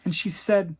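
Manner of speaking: plain reading voice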